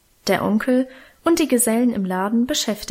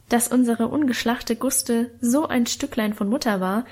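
usual speaking voice

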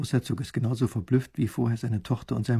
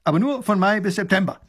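proud soldier, high and loud; 8 semitones higher, 4 dB louder than usual. A narrator's reading. Soldier completing his duty report, proudly. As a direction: add the details proudly. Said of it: "sagt der Mann stolz".

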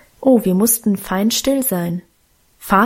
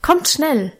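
urgent call, higher pitch; 5 semitones higher than usual. A narrator's reading. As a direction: call the sisters over quickly. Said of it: "rief sie laut".

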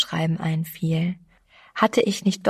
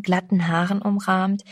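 ordinary delivery, nothing marked

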